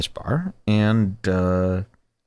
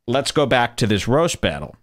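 ordinary delivery

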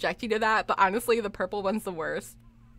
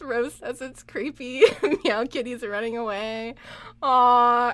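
ordinary talk

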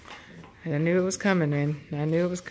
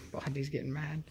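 normal talking voice

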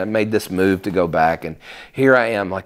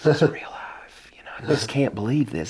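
stunned whisper; 3 semitones higher, 5 dB quieter than usual. Retold or imitated. Retold disbelief on phone call.

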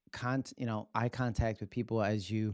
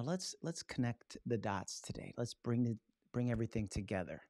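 quiet flat delivery; 8 dB quieter than usual. Shown as a demonstration. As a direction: say it without any lift. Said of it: "if I'm saying to you".

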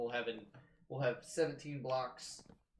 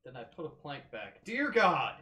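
ordinary delivery

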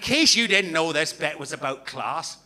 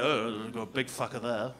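posh voice; 9 semitones lower, 10 dB quieter than usual. Retold or imitated. Comic mimicking posh people at old photos, soft mock posh. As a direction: lightly sneer like a posh onlooker. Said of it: "Posh people are like".

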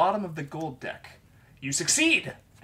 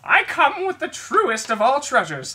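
put-on high, loud toothless old man; Corey voice; 10 semitones higher, 11 dB louder, slower than usual. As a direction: announce it like a toothless old storyteller. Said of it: "here comes my best Corey voice".

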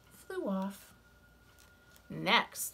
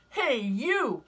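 high, loud, grumpy bug; grouchy ladybug voice; 6 semitones higher, 7 dB louder than usual. Acted. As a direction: bark the challenge up high. Ladybug challenges lobster.

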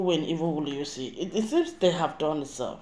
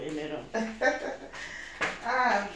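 ordinary talk

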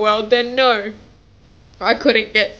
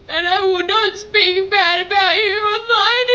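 crying plea; 9 semitones higher, 5 dB louder than usual. Real, not acted. Tearful plea to ex-husband.

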